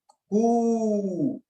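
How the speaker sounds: कू held very long, three beats; extra-long vowel. Shown as a demonstration. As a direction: sustain कू like a rooster's call. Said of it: "जिसको कहते हैं प्लुत". (कू को प्लुत, बहुत लंबा खींचकर)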